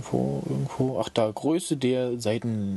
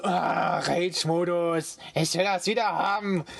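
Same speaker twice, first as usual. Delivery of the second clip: mock rage; 6 semitones higher than usual. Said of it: "Ich sag so".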